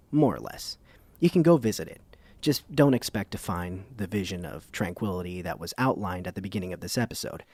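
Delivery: ordinary talk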